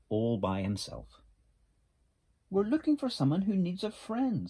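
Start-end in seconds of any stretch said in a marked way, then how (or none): 2.5-4.5 high-pitched, louder animal voice; high storybook character voice; little beaver. A narrator's reading. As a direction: speak up as an eager-to-help little beaver. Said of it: "said Little Beaver".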